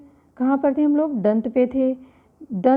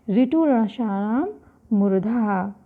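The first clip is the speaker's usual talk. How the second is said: slow sutra recitation; slower than usual. Shown as a demonstration. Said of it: "इसका सूत्र क्या है?".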